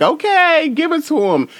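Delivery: very high, loud cheer; squealed hype. Retold or imitated. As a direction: shriek it like a thrilled fan. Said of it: "I was like".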